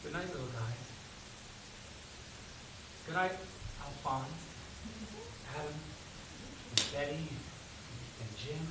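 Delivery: soft, tender goodnight to pets. Acted. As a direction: murmur goodnight softly to tiny pets. Saying goodnight to pet ants.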